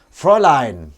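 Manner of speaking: loud mock German Fräulein; German accent. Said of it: "German accent".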